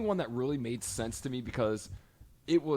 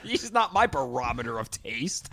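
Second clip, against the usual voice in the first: high, loud comic rant; heated objection; 7 semitones higher, 4 dB louder than usual. Real, not acted. Co-host scoffs at the Harmony Korine defence, heated rant. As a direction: push back hard, pitch jumping up.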